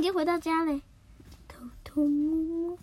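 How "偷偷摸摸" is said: slow, whispered tiptoeing; sneaky whisper. Acted. Nobita sneaking out of the house, whispering slowly. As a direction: whisper as if sneaking past mom.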